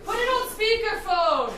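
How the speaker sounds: loud call. Acted. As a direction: call the fix across the room. Shouting advice.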